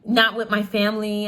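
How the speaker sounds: usual speaking voice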